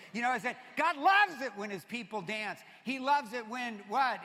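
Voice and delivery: loud, high preaching call; preaching at full force. Acted. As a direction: drive it home to the congregation. Preacher building to a shout.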